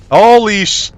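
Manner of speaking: high, loud startled cry. Real, not acted. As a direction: blurt it out in fright. Startled by a scare.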